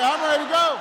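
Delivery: high, loud answer; shouted reply. Retold or imitated. Staff shout their loud reply back to the candidate. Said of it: "They say".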